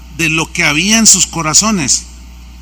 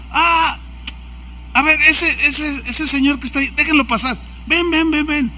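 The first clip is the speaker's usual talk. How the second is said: lightly acted politician, higher pitched, calling someone over; López Obrador; 6 semitones higher than usual. President picks one man from the crowd, lightly acted call. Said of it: "Pero luego él ... le dice a uno".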